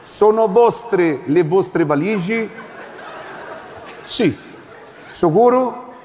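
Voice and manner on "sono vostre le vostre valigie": slow repeat. Acted. Officer repeats slowly.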